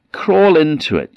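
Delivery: high, loud troll coax; troll woman urging the boy. Acted. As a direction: lure him in, high and pushy.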